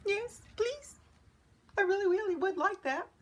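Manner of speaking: high, small wolf voice; shy acceptance. A narrator's reading. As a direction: accept meekly in a lifted little voice. Lonely wolf accepts the kind invitation.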